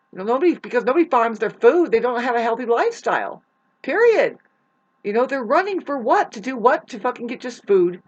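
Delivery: fast, loud, rising exasperation; heated rant. Real, not acted. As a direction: let the frustration boil over. Heated rant about food system.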